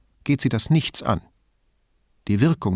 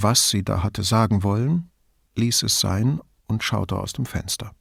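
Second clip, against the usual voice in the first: ordinary delivery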